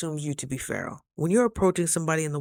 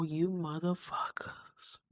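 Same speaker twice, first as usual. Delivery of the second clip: slow unvoiced whisper, much softer; hissed curse; 10 dB quieter, slower than usual. Whispering a curse at her own parasites, slow and soft. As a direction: breathe the curse out slowly, no voice.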